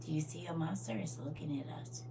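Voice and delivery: put-on quiet creepy child whisper. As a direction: breathe it like a scared little girl. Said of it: "she walked over to me and she said".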